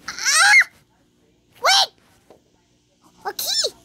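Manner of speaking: high, loud yelp of surprise; startled yelp. Real, not acted. Player gets startled mid-game, high startled yelp. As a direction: cry out, suddenly startled.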